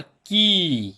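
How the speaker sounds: की with a very long held ई; long vowel. Shown as a demonstration. Teacher showing pupils the big ई, की slowly drawn out. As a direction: stretch out the vowel of की. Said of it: "बड़ी ई का उच्चारण".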